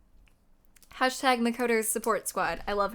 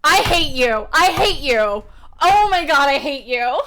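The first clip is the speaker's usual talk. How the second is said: much louder furious yell; angry yelling; 4 semitones higher, 11 dB louder than usual. Real, not acted. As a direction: hurl it at the screen. Yelling at the game.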